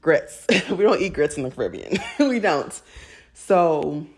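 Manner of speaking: ordinary talk, nothing marked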